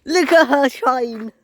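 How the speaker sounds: excited. Real, not acted. Excited bus spotter sees bus.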